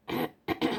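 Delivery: quick clearing of the throat. Real, not acted. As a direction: clear the throat before speaking. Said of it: "Excuse me".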